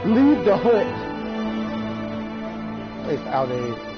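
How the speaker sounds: ordinary talk, nothing marked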